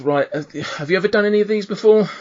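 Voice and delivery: lightly voiced brisk event steward; tiefling official. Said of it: "and the tiefling says".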